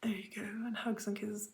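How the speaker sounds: hushed whisper, showing the threads; voiceless whispered aside. Real, not acted. Whispering thread names to camera.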